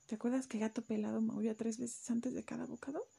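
soft whispered aside. Real, not acted. Reader whispering a reminder to the child. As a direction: lean in and whisper the secret.

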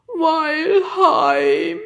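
loud, high, drawn-out creature wail; tearful sniffling. A narrator's reading. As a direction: sob it slowly in homesick misery. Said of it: "schniefte Icy". (weinerlich, laut und hoch geheult)

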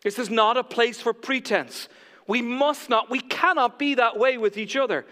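loud, emphatic preaching; forceful pulpit delivery. Real, not acted.

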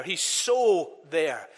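He's "so" drawn out slowly and leaned on; slow, weighty "so"; stress on so. Real, not acted. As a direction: stretch the word "so" long and slow. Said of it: "You notice the emphasis".

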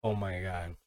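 ordinary talk, nothing marked

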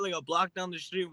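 ordinary delivery

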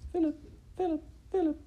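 high, far birdlike call; bird calling. Acted. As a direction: sing out the name like a bird. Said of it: "called out his name".

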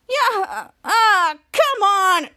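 loud, high straining outburst; mock exasperated yell; retold frustration. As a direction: grunt and shout as if struggling. Acting out Ray struggling.

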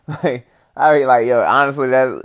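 ordinary talk

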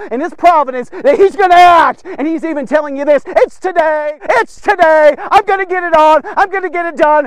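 climbing to a loud, high preacher's cry; fiery sermon peak; preaching at full force. Acted. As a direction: build the crowd up to a roar.

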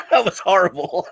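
verdict through helpless laughter; laughing. Real, not acted. Co-host judging the bad Boston attempt, laughing. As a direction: barely get it out while cracking up.

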